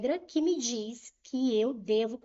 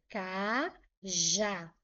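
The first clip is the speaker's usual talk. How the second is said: "cajá" slowly, as two separate syllables; split into syllables; 3 semitones lower than usual. Shown as a demonstration. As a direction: spell out "cajá" syllable by syllable, with a gap. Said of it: "Se eu for separar em sílabas". ("cajá" separado em sílabas, devagar)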